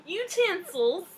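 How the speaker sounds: high, drawn-out word through laughter; laughing. Real, not acted. Swapping in an easier word for co-host, laughing. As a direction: stretch it out while still cracking up. Still laughing over cutlery.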